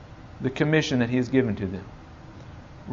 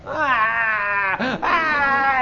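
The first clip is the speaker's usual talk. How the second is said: mock child whine; 11 semitones higher than usual. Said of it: "he's crying the whole way".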